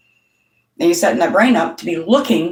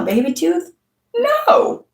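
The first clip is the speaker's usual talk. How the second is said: disbelieving squeak on the no; incredulous scoff; 10 semitones higher than usual. Acted. Mocking the dentist's root canal plan, incredulous.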